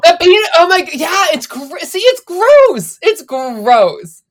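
disgusted shriek. Real, not acted. Podcast cohost squealing over a reality show scene, disgusted.

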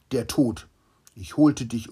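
loud, cutting royal verdict; harsh proclamation. Acted. King to the doctor, announcing his death, loud and harsh. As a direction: throw the death sentence at him like a blow.

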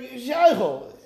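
drawn-out careful "she'oichel"; slow demo. Shown as a demonstration. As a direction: spell out "she'oichel" slowly as one word. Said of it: "It sounds like she'oichel".

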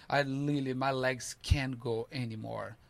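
ordinary talk

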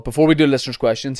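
normal talking voice

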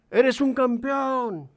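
high, slow, ringing call. A narrator's reading. Father calls praise to the mountain, slow call. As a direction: stretch the words across the valley. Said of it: "el padre grita".

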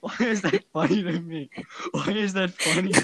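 breaking into giggles; can't stop laughing. Real, not acted. Laughing fit.

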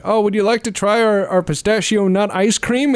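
high, sing-out customer service voice; polite shop clerk. Acted. Clerk offering the invader ice cream, high polite voice.

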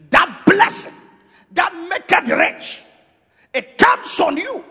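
high, slow, strained cry; preaching peak. Real, not acted. Preacher crying out the blessing to his church.

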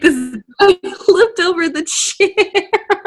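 high, slow words dissolving into laughter; laughing. Real, not acted. Co-host picturing the guy flipped over, laughing. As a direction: let the laugh take over the sentence. Cracking up at the reveal.

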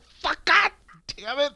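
very high, slow laughing yelp; caught-off-guard yelp. Retold or imitated. Reliving the moment for his co-host, slow high yelp. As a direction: stretch it out, laughing in shock. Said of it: "I just fucking like".

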